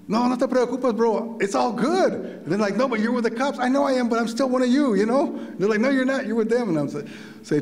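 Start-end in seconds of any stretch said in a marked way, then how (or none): 0.1-2.1 high-pitched, animated reassurance; acted-out pleading reassurance; insistent reassurance. Reassuring convicts at the bars. 2.8-5.4 high-pitched, animated back-and-forth; insistent retold dispute; acted-out argument. Acted argument with doubting convicts. 5.8-6.7 high-pitched, animated retort; insistent retold contradiction; acted-out argument. Convicts argue back.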